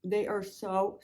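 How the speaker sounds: talking with mouth full; words through a bite of brownie. Real, not acted. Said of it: "sorry, I'm talking with my mouth full".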